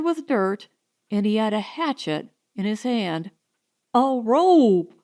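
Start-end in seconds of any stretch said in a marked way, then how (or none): 3.9-4.9 urgent cry for a rope; a hurried demand for a rope; demanding shout. Acted. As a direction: call out for a rope, demanding it now. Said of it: "he demanded".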